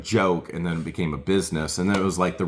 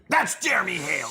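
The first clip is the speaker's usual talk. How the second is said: mock confident witness; 13 semitones higher than usual. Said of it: "she's like".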